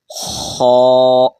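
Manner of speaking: خو with a rasping throat sound, loud and drawn; throaty kh demo. Shown as a demonstration. Teacher demonstrating the khe sound for pupils. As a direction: scrape خو from the back of the throat. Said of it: "کیا پڑھا".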